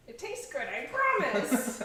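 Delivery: high wheedling witch. Acted. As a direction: coax like a sly witch. Continuing the witch bit.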